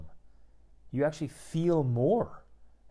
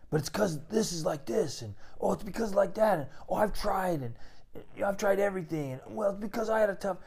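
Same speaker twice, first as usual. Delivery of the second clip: higher, rushing mock-whiny excuse-making; 8 semitones higher than usual. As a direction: parody a guy making excuses, higher and faster. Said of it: "you might be tempted to say".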